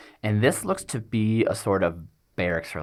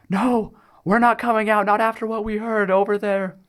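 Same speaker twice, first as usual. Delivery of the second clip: very high, raised voice of someone refusing; voice shouting back; 14 semitones higher, 6 dB louder than usual. A narrator's reading. People hiding in the other room answer the gnome, high defiant shout. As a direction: call back in a high-pitched yell. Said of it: "back from the other room, you hear".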